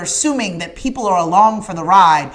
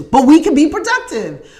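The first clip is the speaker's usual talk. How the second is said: laughing; 6 semitones higher, 7 dB louder than usual. Real, not acted. Laughs while returning to point.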